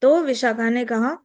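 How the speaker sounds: ordinary delivery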